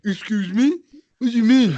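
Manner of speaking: high, loud indignant retort; high-pitched "how dare you" indignation; offended outrage. Acted. Acting out offended reply to insult.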